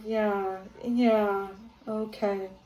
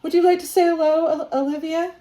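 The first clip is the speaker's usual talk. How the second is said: higher and louder, hopeful question; eager invitation; 7 semitones higher, 6 dB louder than usual. Real, not acted. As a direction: coax the spirit to answer. Invites the spirit to speak.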